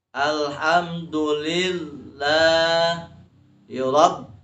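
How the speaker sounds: slow chant, pressing on Rabb; tasdid on Rabb. Shown as a demonstration. Teacher pointing out the fourth tasdid, chanted with Rabb held. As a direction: hold the doubled b of Rabb. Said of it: "di sana tasdid".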